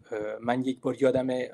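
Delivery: usual speaking voice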